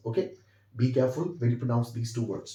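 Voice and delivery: usual speaking voice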